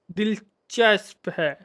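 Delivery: दिलचस्प stretched out slowly, landing on a clear s-p; slow, deliberate दिलचस्प, soft and careful; careful pronunciation. Real, not acted. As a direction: take दिलचस्प at half speed, sound by sound. Repeats the word to get it right.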